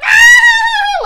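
loud, very high imitated scream; piercing re-enacted "aah"; freaked-out shriek. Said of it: "Like, freaking out".

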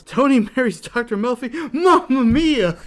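hammed-up yell, high and loud; yelling in disbelief. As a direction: imitate an astonished outburst, stretching the exclamation. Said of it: "at which point he yells".

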